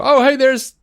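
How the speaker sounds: high-pitched imitation of a thrilled fan; mock excited fan. Imitating comic book fans spotting a cameo, mock excited. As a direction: gush like a starstruck fan, mockingly.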